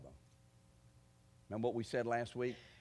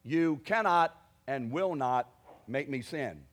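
louder, slow, each word spaced out; defiant rebuke; 7 dB louder, slower than usual. Shown as a demonstration. Preacher shows how he rebukes the tempter, slow and loud. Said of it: "That's what I do".

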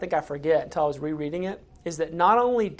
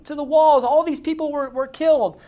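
much higher and louder, indignant demand; a king blowing up at his general; hammed-up outraged king; 11 semitones higher, 5 dB louder than usual.

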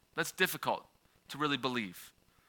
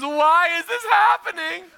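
put-on high, loud, slow childish wail at the sky; dramatic wail; 13 semitones higher, 7 dB louder, slower than usual. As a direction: imitate a heartbroken four-year-old crying out. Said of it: "yelled at the heavens".

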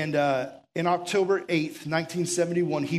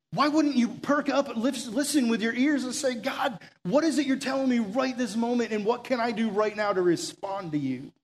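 higher, pleading call to listen; urgent preaching; 4 semitones higher than usual. Acted. Pastor urging the congregation to perk up, pleading. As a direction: lift the room to attention.

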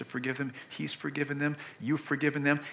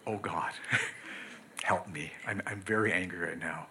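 hushed prayer; 8 semitones lower, 10 dB quieter than usual. Retold or imitated. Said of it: "to our children".

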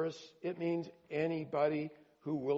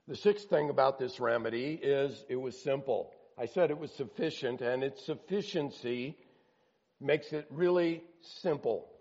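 usual speaking voice

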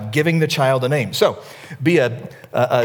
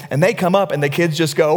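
ordinary talk

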